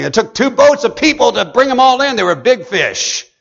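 shouting the good news from the pulpit; fiery preaching. Acted. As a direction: build the crowd up with fire in every line. Preaches at full force.

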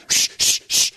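loud, repeated shh; urgent hushing. Acted. Bystander hushing everyone gathered near, urgent shushing. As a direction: hush the whole room sharply.

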